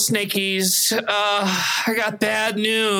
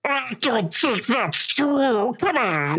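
ridiculous voice; 4 semitones higher, slower than usual. Acted. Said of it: "you sound ridiculous".

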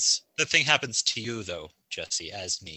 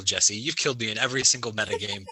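ordinary delivery

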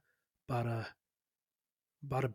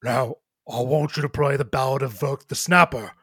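rough-mannered half-orc character voice; 3 dB louder than usual. Acted. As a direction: demand it as a massive half-orc. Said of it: "large half-orc ... and saying".

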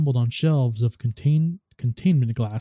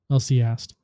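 plain reading voice

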